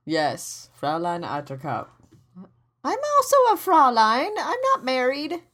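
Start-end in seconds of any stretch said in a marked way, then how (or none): 2.8-5.4 indignant. Pretend. Indignant mock protest.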